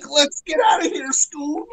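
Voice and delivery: high panicky cartoon Shaggy. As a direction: squeak it out like scared Shaggy. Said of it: "They're like".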